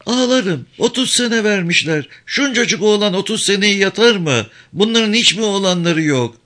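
put-on high, stubborn old woman insisting she will cry; defiant grieving mother. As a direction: protest the thirty-year sentence.

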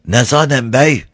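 "Bey" in the herdsman's own dialect pronunciation. A narrator's reading. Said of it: "Herif bey yerine bey diyordu".

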